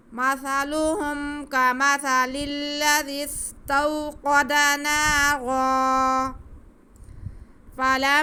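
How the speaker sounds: slow, high, loud chant without a breath; long tajweed phrase in one breath. Shown as a demonstration. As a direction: carry the whole phrase on a single breath. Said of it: "ایک سانس میں وہ پڑھ سکتے ہیں".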